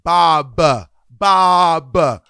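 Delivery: "Bob" drawn out loud, pointedly; over-enunciate "Bob" as a fussy correction; mock correcting. Refusing to correct someone's accent, mock-pedantic loud correction. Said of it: "I wouldn't be like".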